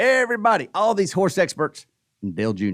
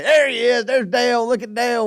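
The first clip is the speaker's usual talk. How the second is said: raised-pitch party callout; excited spotting; 5 semitones higher than usual. Retold or imitated. Party guest seeing Dale on screen, excited callout. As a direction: call out a friend spotted on TV. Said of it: "somebody's gonna say".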